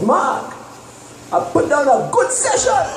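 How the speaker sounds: proud, grinning boast; grinning buddy. Retold or imitated. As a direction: say it with a big grin. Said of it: "Cheshire Cat smile and he says".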